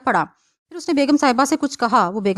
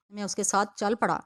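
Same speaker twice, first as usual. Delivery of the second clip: ordinary delivery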